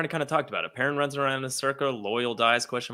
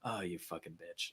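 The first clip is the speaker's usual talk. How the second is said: much softer, almost under breath; muttered aside; 8 semitones lower, 18 dB quieter than usual. Retold or imitated. Podcaster mutters at Nynaeve, softly under breath. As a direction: drop the voice to a low mutter.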